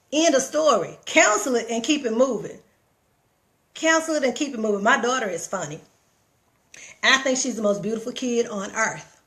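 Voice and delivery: ordinary talk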